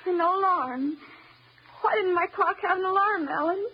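tearful pleading. Acted. Wife breaking down over clock.

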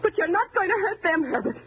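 high, overwrought defiance; emotional warning to back off; impassioned protest. Acted. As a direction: defend them fiercely, pitch held high. Defends young couple from him.

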